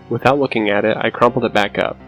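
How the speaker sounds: ordinary delivery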